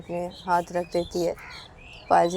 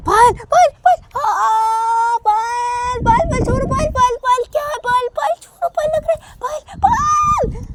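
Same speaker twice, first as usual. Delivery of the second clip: very high, loud, crying shrieks of her name; screaming in pain; 10 dB louder than usual. Acted. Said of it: "बहुत ज़ोर से चीखने लगती है".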